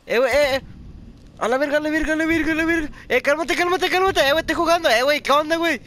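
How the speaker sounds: very high, loud frantic cries; frantic yelling. Real, not acted. Gamer yelling frantically at his teammate in a firefight, high and loud. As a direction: shout in a panic mid-fight.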